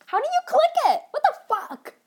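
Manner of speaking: high, loud, baffled squeal. Real, not acted. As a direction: shriek at your own failed attempt. Failing to click tongue.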